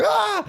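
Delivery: high yelp as the ankle twists; mock cry of pain; retold pain cry. Ankle twisting in the parking lot, a high yelp. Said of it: "I was like".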